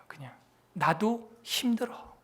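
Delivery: slow tired whisper; weary pastor whispering to the congregation. Real, not acted. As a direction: confide it under your breath.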